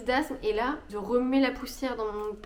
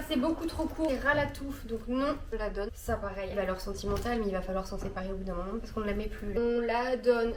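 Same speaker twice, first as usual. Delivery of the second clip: normal talking voice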